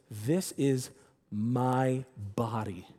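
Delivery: slow solemn recital. Acted. Preacher recites Jesus' words at the meal, slow and solemn. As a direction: linger on "my body" with weight.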